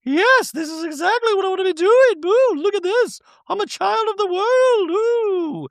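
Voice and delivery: very high-pitched mock-chirpy caricature; ditzy reality-star send-up, far above normal pitch; Dorit. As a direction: squeal it out in a silly falsetto. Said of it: "And she's like".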